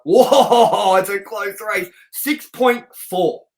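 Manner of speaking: slow, amazed announcement of the number; excited reveal. Real, not acted. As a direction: savour each word of the close score. Reading the close rating aloud.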